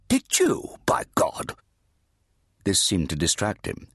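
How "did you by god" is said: hushed whisper, high; whispered aside. A narrator's reading. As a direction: breathe it out in a surprised whisper. Redeemer reacts to news.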